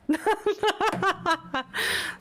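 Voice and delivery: bright high burst of laughter. Real, not acted. Streamer laughing at chat, high burst of laughter.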